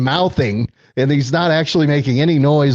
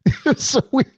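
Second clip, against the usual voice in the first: laughing; 14 semitones higher than usual. Real, not acted. Laughing repeat.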